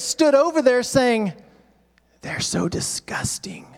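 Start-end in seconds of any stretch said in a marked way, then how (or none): 2.2-3.7 hushed scornful whisper; whispered gossiping contempt; disgusted whisper. Acted. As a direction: whisper it behind your hand in disgust. Religious hypocrites sneering at sinners.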